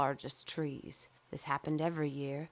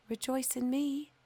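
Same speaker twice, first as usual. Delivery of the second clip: higher, gentle call; breeze and sunshine voice; 9 semitones higher than usual. Acted. Breeze and sunshine urging the fir, higher and gentle. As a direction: invite the tree to joy.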